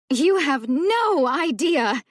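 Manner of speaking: slow, high shout with NO stretched. A narrator's reading. Mocking her sister's phone call, shouted slowly. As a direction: yell "You have no idea" drawn out. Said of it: "she shouted".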